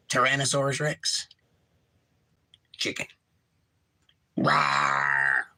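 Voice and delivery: low, growled mock T-rex roar; dinosaur roar. Dinosaur then chicken, then roar.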